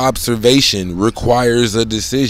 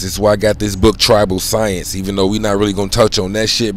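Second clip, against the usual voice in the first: ordinary talk, nothing marked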